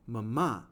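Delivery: "mama" with a light first and strong second syllable; iambic demo. Shown as a demonstration. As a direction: stress the end of "mama". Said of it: "it's five iambs per line".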